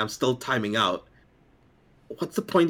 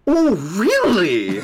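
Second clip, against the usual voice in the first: high, loud, drawn-out mock amazement; overly sarcastic; 12 semitones higher, 7 dB louder, much slower than usual. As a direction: lay fake astonishment on thick, stretching the words. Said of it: "I become overly sarcastic".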